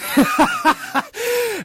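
high giggling laugh. Real, not acted. Host laughing at his own story, high giggle.

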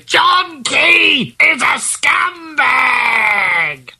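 slow, drawn-out announcement through a megaphone; megaphone shout. Shown as a demonstration. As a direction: blare it out high, stretching the words. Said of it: "on a megaphone, for instance".

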